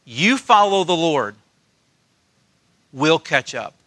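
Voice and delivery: a gentle hint of mom letting him go; gentle mother. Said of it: "in the most gentle way".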